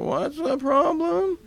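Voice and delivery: lightly voiced wife asking from bed.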